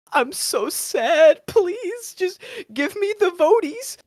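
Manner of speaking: milk the sympathy like a sad puppy; pitiful whine. Acted. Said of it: "play into that and be like".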